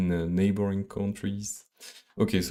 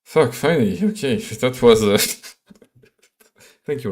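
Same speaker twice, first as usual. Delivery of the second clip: loud, higher burst of relief; relieved outburst; 3 semitones higher, 8 dB louder than usual. Real, not acted. Programmer to stream as code finally works, relieved outburst.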